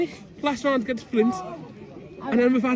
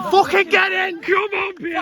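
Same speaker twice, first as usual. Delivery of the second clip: much higher, louder swearing cheer; rowdy jeering pub banter; 11 semitones higher, 5 dB louder, slower than usual. Real, not acted. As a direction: roar it in triumph. Celebrating the win.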